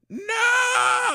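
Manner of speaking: high, long screamed refusal; hammed-up shrieking protest; screaming. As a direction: scream it as the horrified consultant. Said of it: "she just starts screaming".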